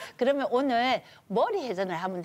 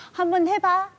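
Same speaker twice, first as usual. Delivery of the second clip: higher, louder challenging voice; elder's gruff dare; 9 semitones higher, 7 dB louder than usual. Retold or imitated. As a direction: dare the instructor to try. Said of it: "이렇게".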